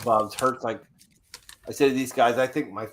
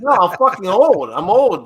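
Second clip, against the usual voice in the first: loud, higher, exasperated complaint; a retold outburst at his wife, loud and higher; exasperated protest; 8 semitones higher, 12 dB louder than usual. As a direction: protest that you're too old, loudly.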